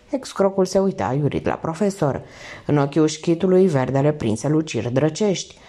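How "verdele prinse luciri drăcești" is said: ordinary delivery, nothing marked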